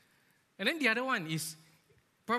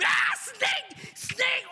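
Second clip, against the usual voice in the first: very high, loud shriek of panic; panicked scream; 7 dB louder, much slower than usual. Retold or imitated. Office worker spotting a snake at the door, panicked scream. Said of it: "your first reaction was to scream".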